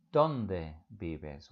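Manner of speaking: normal talking voice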